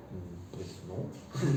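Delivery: deadpan dismissal. Retold or imitated. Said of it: "yo digo".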